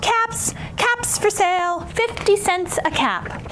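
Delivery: high singsong peddler's cry; street vendor call. Acted. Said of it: "he called".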